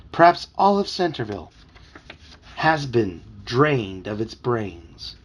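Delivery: slow, portentous delivery with long pauses; dramatic pronouncement. A narrator's reading. Puppy warns Toby the town is lost, slow and dramatic. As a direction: draw out the grim theory.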